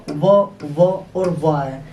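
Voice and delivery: slow, drawn-out व repeated; stressing the v sound. Shown as a demonstration. Teacher hammering home the v sound for students. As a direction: lean on each व slowly. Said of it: "आप notice करें हर जगह व".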